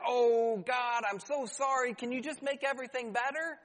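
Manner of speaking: high-pitched, insincere pleading; imitating a self-serving apology to God; mock crying out. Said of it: "we cry out to the Lord".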